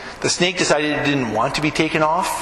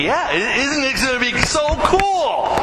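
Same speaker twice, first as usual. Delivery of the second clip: excited; 9 semitones higher than usual. Retold or imitated. Excited pastor retelling his reply.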